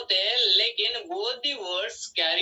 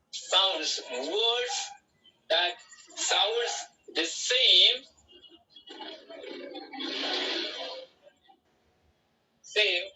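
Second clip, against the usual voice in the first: slow dictation; much slower than usual. Shown as a demonstration. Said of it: "dictating the definition slowly".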